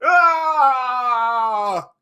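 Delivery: high mock death shriek; a hammy dying yelp; imitated scream.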